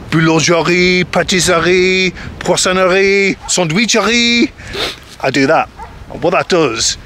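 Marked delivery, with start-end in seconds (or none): 0.1-4.5 put-on showy French accent, rising higher word by word, slowed; best French accent. Showing off to French shopkeepers in a put-on French accent. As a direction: lay the French accent on thick for the shop names. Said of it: "I put on my best hello, hello accent".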